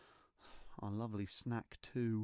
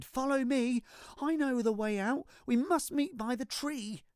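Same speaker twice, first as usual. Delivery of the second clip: put-on high, loud urgent little-kid call; child leading; 11 semitones higher, 4 dB louder than usual. A narrator's reading. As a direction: lead the way out, eager and loud. Child leads dragon out.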